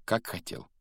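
ordinary delivery, nothing marked

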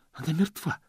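high breathless gasp; задыхаясь. A narrator's reading. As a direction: say it gasping for air, high. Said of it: "задыхаясь, проговорил он". (задыхаясь, высоким голосом)

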